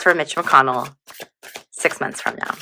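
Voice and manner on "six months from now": unvoiced whisper to the deck. Real, not acted. Whispering the time frame to the tarot deck. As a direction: breathe the question to the cards.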